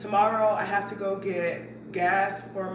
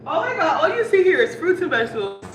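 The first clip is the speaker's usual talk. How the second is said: laughing; 7 semitones higher, 7 dB louder than usual. Real, not acted. Laughing at her own groceries.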